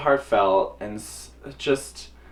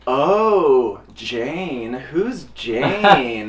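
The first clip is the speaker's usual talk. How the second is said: curious mom; 3 semitones higher, 4 dB louder, much slower than usual. Retold or imitated. Mom mishears name.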